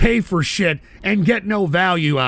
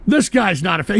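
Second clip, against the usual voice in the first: normal talking voice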